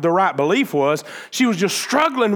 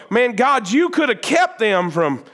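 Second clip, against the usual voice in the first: higher, raised cry at God; frustrated outburst; 7 semitones higher than usual. Retold or imitated. Someone sitting there crying out to God, raised and higher. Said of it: "you're sitting there and you go".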